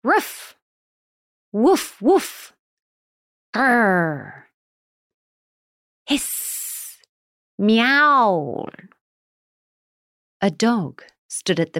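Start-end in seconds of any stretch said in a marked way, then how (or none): 0.0-0.6 sharp high yappy bark; dog bark. Acted. Dog barks at cat. 1.5-2.5 two loud high barks; loud dog barks. Acted. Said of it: "barking loudly and heatedly". 3.5-4.4 a rolling growl; dog growl. Acted. 6.1-7.0 soft voiceless hiss; cat hiss. Acted. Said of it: "It hissed wildly and angrily". 7.6-8.7 loud high meow; cat meow. Acted. Cat in the tree.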